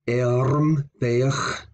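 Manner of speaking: Ermberg with a throaty ch at the end; final fricative g. Shown as a demonstration. Teacher repeating the word for arm ring, fricative ending. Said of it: "has a fricative sound".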